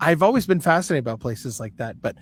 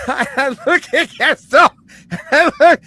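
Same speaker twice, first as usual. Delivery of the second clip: laughing through the words, high and loud; 8 semitones higher, 7 dB louder than usual. Real, not acted. Remembering the scared cadets, laughing while telling.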